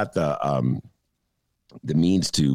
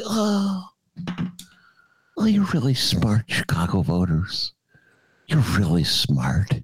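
sarcastic sweet-talk, drawn out; fake-nice praise dripping with sarcasm; mock flattery; 2 semitones lower, slower than usual. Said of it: "You want me to be nice to you and say".

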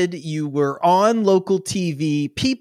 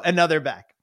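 ordinary talk, nothing marked

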